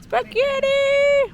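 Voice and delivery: high, slow, stretched delighted echo; excited echo. Real, not acted. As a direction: draw it out in mock delight. Excited echo of partner's answer.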